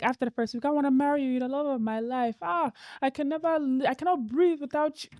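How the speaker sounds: hammed-up Nigerian suitor gushing; Nigerian accent. Friend imitating a Yoruba suitor in a Nigerian accent. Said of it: "I love the accent".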